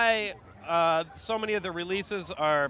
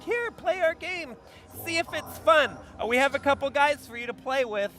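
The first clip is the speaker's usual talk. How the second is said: higher, chirpy developer voice; dev voice; 4 semitones higher than usual. Retold or imitated. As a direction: pitch it like a smug dev. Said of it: "they're like".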